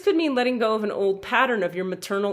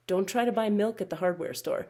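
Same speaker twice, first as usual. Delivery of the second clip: ordinary talk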